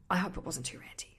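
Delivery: a sheepish whisper to herself; whispered aside. Real, not acted. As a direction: breathe it out, half to yourself. Self-conscious aside after rant.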